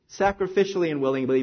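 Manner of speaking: normal talking voice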